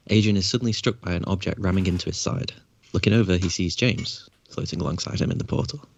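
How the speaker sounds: ordinary talk, nothing marked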